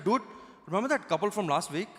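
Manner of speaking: Indian friend sharing gossip; chatty Indian-accented English. Retold or imitated. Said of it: "two of my Indian friends".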